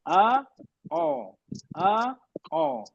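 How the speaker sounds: آ light, او heavy, alternating; aa and o endings. Shown as a demonstration. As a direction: alternate آ and او clearly. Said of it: "آخر میں آواز آئے گی".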